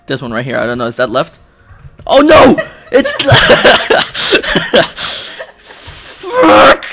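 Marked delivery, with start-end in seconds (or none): 2.0-6.8 loud, high dismay breaking into laughter; screaming. Real, not acted. Player failing with the timer running, screaming. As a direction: scream it, laugh, then curse hard. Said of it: "I've been screaming".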